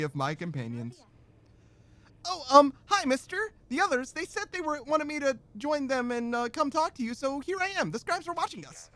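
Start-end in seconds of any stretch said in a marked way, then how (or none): 2.3-8.9 put-on high, hesitant girlish voice; shy young girl. Fay shyly greeting the reader in a high girlish voice. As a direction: pitch up and stumble bashfully.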